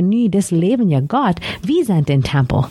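ordinary talk

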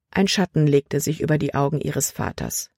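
usual speaking voice

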